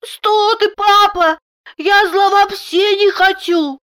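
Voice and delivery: put-on very high childish voice; little girl assuring. A narrator's reading. Daughter assures papa she meant no harm, in child voice. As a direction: protest innocence like a small child. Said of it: "заверила".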